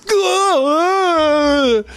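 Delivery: high, sudden mock wail; a burst of imitated sobbing, jumping right to the waterworks; retold wail. Father breaking down in front of the principal, mock wail.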